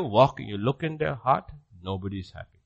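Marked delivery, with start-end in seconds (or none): none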